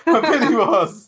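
squeaky laughing, slow; laughing high. Real, not acted.

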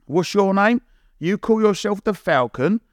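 high, mocking challenge; retold grilling, pitched well up; slating. Lord Sugar slating a candidate over his name, scornful questioning. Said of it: "started slating everyone".

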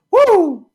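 loud, very high "woo"; piercing cheer of triumph; celebratory whoop. Real, not acted. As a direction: let out a big high whoop. Celebrating the show's new platform.

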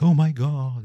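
high, loud mock-shocked exclamation; mock outrage. Imitating shocked critics of swearing.

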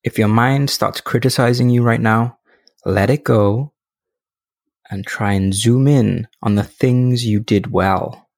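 unhurried guided reflection, leaving space after each phrase; guided meditation. Acted. Podcast host guiding listeners through end-of-day review, calm meditation voice.

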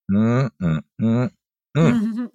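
drawn-out savoring mm hums; approving hum. Retold or imitated. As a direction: hum like you're savoring music. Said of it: "universal, uh, affirmative approval sound called".

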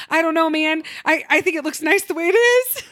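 nervous high reply. Retold or imitated. Her nervous reply to owner.

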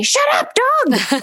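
high, mock yell at the dog; half-mock shout of shut up dog. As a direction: snap it out like an annoyed owner. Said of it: "run outside and say".

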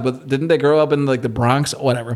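ordinary delivery

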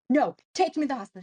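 loud, rapid mock yell; retold yelling. Wife demanding help from her husband, retold yelling. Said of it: "I was screaming and yelling at my husband".

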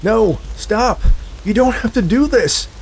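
high, loud, panicked begging; desperate pleas. A narrator's reading. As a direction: scream for mercy as the demon reaches. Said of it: "his pleas were swallowed".

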